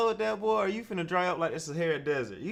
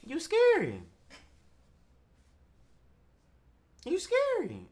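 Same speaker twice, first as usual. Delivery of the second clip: high mocking taunt; teasing; 9 semitones higher than usual. Host taunting the scared guest, high mock. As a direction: needle him with a high sing-song.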